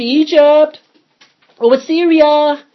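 high, loud sing-out call. Acted. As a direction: holler over to faraway neighbours, drawn out. Calling out to the nations.

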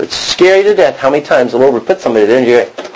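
usual speaking voice

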